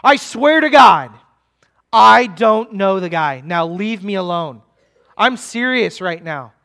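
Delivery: loud sworn outburst, then easing; acted exasperated oath; forceful denial. Peter swearing to accusers he doesn't know him, forceful denial. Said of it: "make it a little bit more forceful".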